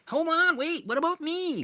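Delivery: high, loud, pleading little voice; a squeaky needy inner child; whiny inner voice. Retold or imitated. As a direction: voice the neglected feelings begging for attention. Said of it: "it's like".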